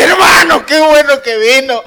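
high, loud mock cry of welcome; wailing greeting. Sister greets the passing preacher, imitated loud tearful cry. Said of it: "cuando yo llore, llore con todo".

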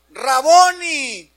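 loud, high, drawn-out cry; shouted cry. Acted. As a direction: cry out slowly in recognition of the teacher. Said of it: "¿Qué gritó?".

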